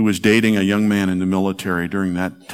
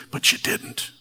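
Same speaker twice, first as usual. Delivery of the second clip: whispered refrain; 5 dB quieter than usual. Acted. Quiet refrain after preaching.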